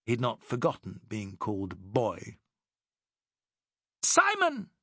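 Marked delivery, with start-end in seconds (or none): 4.0-4.7 much higher, louder call across the blaze; shouted over fire. Acted. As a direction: shout over the burning timbers. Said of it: "shouted Alice above the crack".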